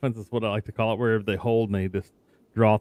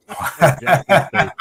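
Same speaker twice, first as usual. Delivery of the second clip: laughing; 7 semitones higher, 6 dB louder, much slower than usual. Real, not acted. Laughing at a 77-point blowout.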